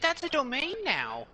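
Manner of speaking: ordinary talk, nothing marked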